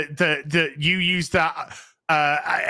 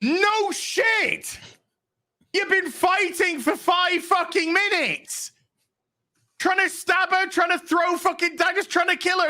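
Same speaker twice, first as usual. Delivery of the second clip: mock outraged shout, much higher; exasperated shout; 13 semitones higher than usual. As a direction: yell it at the screen in disbelief. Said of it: "I'm like".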